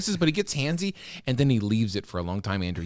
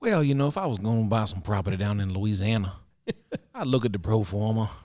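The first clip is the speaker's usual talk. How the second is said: Southern accent; 2 semitones lower than usual. Shown as a demonstration. Co-host showing off his accent on request, Southern accent. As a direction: play a folksy Southerner talking property. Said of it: "Let's hear yours".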